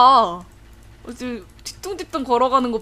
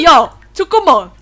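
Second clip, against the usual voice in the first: startled yell; 2 semitones higher, 10 dB louder than usual. Real, not acted. Startled by game event.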